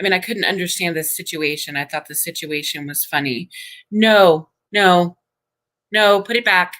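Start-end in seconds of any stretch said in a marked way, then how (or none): 3.9-6.7 sudden parental no. Real, not acted. Mom calling across the room for her son to put it back, loud and firm. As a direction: stop him before he takes it. Stopping her boy at the door.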